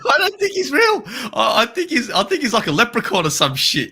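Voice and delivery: high-pitched, cracking up with laughter; laughing disbelief. Real, not acted. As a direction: protest through giggles, pitch jumping up. Joking that the player isn't real.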